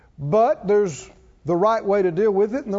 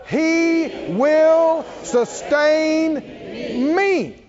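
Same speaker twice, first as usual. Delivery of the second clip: with faith; 7 semitones higher, much slower than usual. Shown as a demonstration. Pastor modeling a faith-filled declaration for the congregation, high and stretched. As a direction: proclaim it like you truly believe. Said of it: "say it with some faith".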